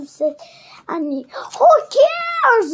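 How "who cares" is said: high, loud, scornful roar; Captain Bone roar. A narrator's reading. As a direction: roar it dismissively, pitched high. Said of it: "roared Captain Bone".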